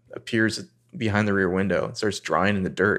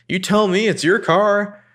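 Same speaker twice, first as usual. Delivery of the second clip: high, louder snippy comeback; mock-indignant Hannah; sassy retort; 12 semitones higher, 4 dB louder than usual. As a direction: throw it back at her. Hannah voiced snapping at Spencer.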